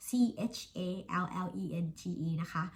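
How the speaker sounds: normal talking voice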